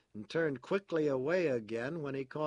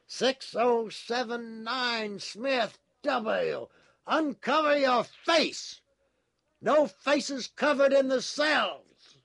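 high, slow, very loud order; drawn-out authoritarian shouting; yelled command; 12 semitones higher, 10 dB louder, slower than usual. A narrator's reading. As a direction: bellow orders like a guard over a loudspeaker. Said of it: "yelled a voice from the telescreen".

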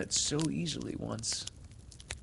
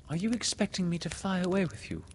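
high, hurried anxious question; faltering; 9 semitones higher, faster than usual. A narrator's reading. Wendy asks whether Peter expects her to fly, faltering. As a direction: ask nervously, voice pitched up. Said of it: "she said falteringly".

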